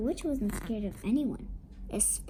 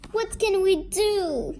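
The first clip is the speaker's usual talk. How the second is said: higher, louder plea; worried plea; 7 semitones higher, 5 dB louder than usual. A narrator's reading. Parents ask their friends for help, worried plea. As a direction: ask it as a raised appeal.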